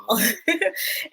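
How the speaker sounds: high-pitched giggle; amused laugh. Real, not acted. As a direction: giggle at your own impression. Amused by own accent imitation.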